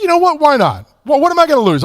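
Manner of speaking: high, excited pep talk to himself; a burst of nervous courage; psyched-up self-talk. Retold or imitated. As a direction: talk yourself into it, pitch jumping up.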